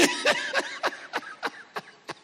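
long, high laugh. Real, not acted. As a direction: burst out laughing at your own story. Laughs at own story.

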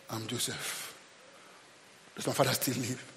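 low, very soft voice; hushed reveal. Acted. Joseph revealing himself to his brothers, low and hushed.

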